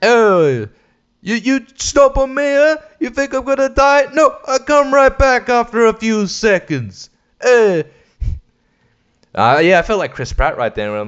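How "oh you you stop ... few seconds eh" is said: high, loud brash tough-guy voice; Dice as Koopa. Retold or imitated. Skeleton Koopa taunting Mario after being stomped, brash. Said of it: "got Dice to voice ... Koopas".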